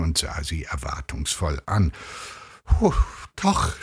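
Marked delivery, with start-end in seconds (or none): 2.6-3.8 high, forced gasping words; strained, high-pitched stammer; blurted out. A narrator's reading. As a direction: push the words out with effort. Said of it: "stieß sie hervor".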